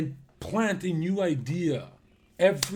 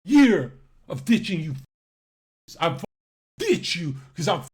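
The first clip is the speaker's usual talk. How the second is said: loud, punched, heated outburst; carried-away rant; 8 dB louder than usual. Real, not acted. Streamer tells viewers he ditched them, carried-away rant. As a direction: get carried away berating your audience. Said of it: "Got a little carried away there".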